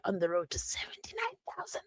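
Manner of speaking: secretive whispered aside. Real, not acted. Telling viewers about nearing subscribers, whispered.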